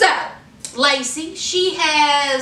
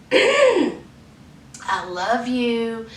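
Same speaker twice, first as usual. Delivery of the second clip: very slow, lower mock-loving voice; lovey phone voice; 4 semitones lower, much slower than usual. [name] on the phone to her incarcerated boyfriend, mock lovey. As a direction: draw out each word like a lovesick caller. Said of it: "she's talking to him on the phone".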